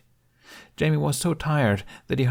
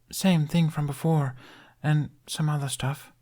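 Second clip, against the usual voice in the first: put-on young boy voice; 8 semitones higher than usual. Narrator voices young Jamie.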